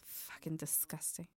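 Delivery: disgusted whisper under breath. Real, not acted. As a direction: hiss it in disgust.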